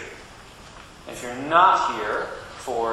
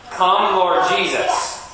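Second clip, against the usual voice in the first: slow, loud, high call-out; far-carrying call; 5 semitones higher, 5 dB louder, much slower than usual. Shown as a demonstration. Minister leads children in the refrain, calling out. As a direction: draw it out loudly for the next town. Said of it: "get Harpersville to hear your part".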